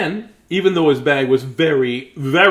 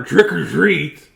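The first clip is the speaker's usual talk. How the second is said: louder, slow trick or treat; slow, louder, higher ask; a little louder; 5 semitones higher, 6 dB louder, much slower than usual. Acted. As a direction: call it out a bit more firmly. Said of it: "So he said it a little louder".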